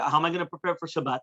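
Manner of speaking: usual speaking voice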